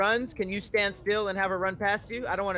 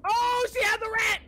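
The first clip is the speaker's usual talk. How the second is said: shouting; 13 semitones higher, 4 dB louder, slower than usual. Real, not acted. Shouting while laughing.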